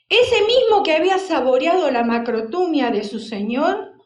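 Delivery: normal talking voice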